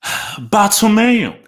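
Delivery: slow, high sigh dragging out the name; exasperated groan. Real, not acted. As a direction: let the name out in despair. Exasperated at club president.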